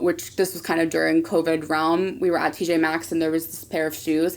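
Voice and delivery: normal talking voice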